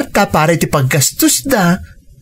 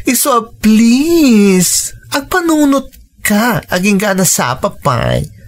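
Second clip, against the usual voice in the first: tearful plea; 2 semitones higher than usual. Acted.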